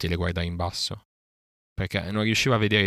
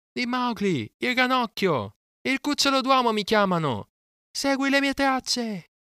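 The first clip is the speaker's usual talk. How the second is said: very high, sustained crying-out; shrieked call. A narrator's reading. Boy shouts to the vulture while flung through the air, high drawn-out cry. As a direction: cry it out high, stretching the last words. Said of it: "Le ultime parole le strillò".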